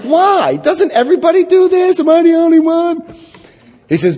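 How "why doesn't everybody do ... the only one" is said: much higher, louder, disbelieving whine; higher, louder protest that it's so unfair; incredulous protest. Retold or imitated. Incredulous retold protest to sponsor.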